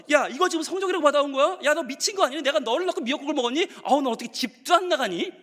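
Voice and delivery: high-pitched mock maternal tirade; scolding mother. Mother seeing the report card, scolding. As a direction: lay into the child like an exasperated mom. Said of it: "엄마가 뭐라 그러죠?".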